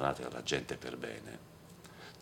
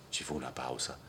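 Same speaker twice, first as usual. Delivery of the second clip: ordinary delivery, nothing marked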